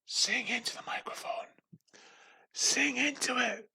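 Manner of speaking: whispered manager impression, slowing at the end; intense whisper. Intense manager forcing poor employee to sing, whispered. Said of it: "who's very intense".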